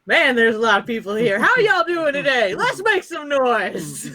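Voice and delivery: hyped speaker working a crowd; pumped up. Acted. Chet takes the podium before students, pumped-up hype. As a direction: raise the pitch and stretch the final call. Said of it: "he's, like, pumped up".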